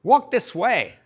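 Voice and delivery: put-on "walk this way" in a comic Russian accent. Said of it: "That was my Russian accent".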